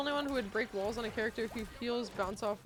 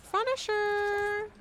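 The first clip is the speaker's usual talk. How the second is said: drawn-out slow call, higher and louder; sing-song call; 9 semitones higher, 4 dB louder, much slower than usual. Pretend. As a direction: sing it out slowly. Calling out the item playfully.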